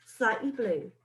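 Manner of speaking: ordinary delivery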